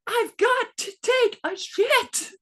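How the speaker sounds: put-on high, slow, desperate child's plea; panicked kid. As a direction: beg like a little boy about to burst. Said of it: "the panic I heard in his voice".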